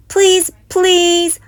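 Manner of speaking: high, loud begging cubs; loud pleading shout. A narrator's reading. Cubs shouting please in the parking lot. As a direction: beg loud enough for the lot to hear. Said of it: "shouted the cubs so loudly".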